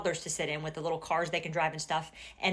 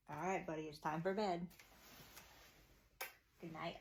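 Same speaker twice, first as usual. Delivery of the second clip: soft and low, don't wake him; hushed bedtime voice; 3 semitones lower, 16 dB quieter than usual. Real, not acted. Mom settling her son for bed, hushed. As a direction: murmur goodnight gently.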